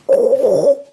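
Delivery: long, high, loud "oh"; rapturous oh. Acted. Being of love greeting you as you turn, rapturous. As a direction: swell into an overjoyed cry. Imitates response of loving being.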